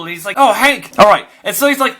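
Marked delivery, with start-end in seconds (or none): none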